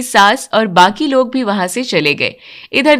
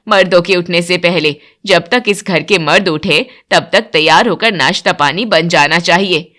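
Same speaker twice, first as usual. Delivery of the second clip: strict mother-in-law; 5 semitones lower than usual. Acted. Mother-in-law laying down house rules to new bride, stern lower voice. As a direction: lay down the rule like a stern matriarch.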